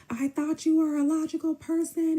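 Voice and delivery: high, stretched-out mimicked holler; hammed-up high-pitched protest; mock yelling. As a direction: imitate him yelling on the phone. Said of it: "what he yelling on the phone".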